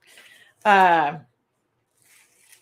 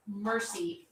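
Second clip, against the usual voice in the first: normal talking voice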